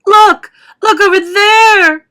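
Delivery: very high, loud shriek; frantic high-pitched yell, stretched; excited scream. A narrator's reading. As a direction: scream it out to alert everyone. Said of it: "she screamed".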